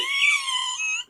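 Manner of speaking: soft, very high squeal of laughter. Real, not acted. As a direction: giggle high and breathless. Laughing at accent complaint.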